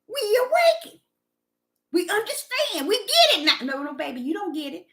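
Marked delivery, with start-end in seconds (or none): 0.1-3.4 higher mocking voice of the awakened; mocking quote. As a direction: mimic them smugly. Mocking the self-proclaimed awakened.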